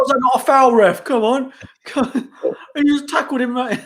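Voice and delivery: high, loud protest at the referee; mock fan shouting. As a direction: yell it like an outraged football fan. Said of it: "We'll be shouting like".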